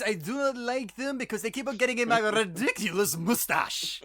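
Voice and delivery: high, loud hammed-up Belgian detective; mock Poirot with a Belgian accent. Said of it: "Kenneth Branagh movie where he keeps sending back eggs".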